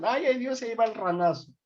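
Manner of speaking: high-pitched, hammed-up squeamish cry; mock panic at blood. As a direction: act out someone about to faint at the sight of blood. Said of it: "ven sangre y dicen".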